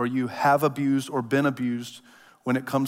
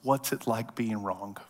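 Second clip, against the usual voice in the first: lovingly polite; 4 semitones lower, 8 dB quieter than usual. Real, not acted. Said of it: "lovingly and politely say to you".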